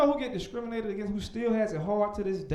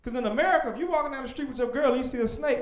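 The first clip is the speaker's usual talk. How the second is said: ordinary delivery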